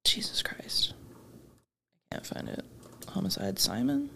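very soft, near-whispered search mutter; muttering to oneself. Real, not acted. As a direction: mumble under your breath while searching. Muttering while searching online.

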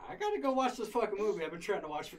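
amused exasperation, laughing it out. Real, not acted. Laughing as he tells them he just wanted his movie.

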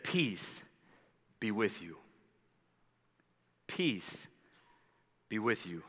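low, soft, unhurried blessing; gentle reassurance. Retold or imitated. Jesus to his frightened disciples, softly calming. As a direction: let each phrase settle quietly, with pauses.

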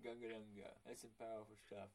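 low quoted movie voice. Retold or imitated.

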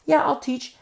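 high, loud, too-ready agreement; mock willing teacher. Paid teacher agreeing before naming a price, mock willing. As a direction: imitate an eager yes before the catch. Mimics a teacher demanding money.

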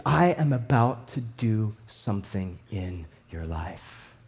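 low, soft, slow voice of God; God speaking. Acted. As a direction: lay God's promise down gently, low. God's promise voiced.

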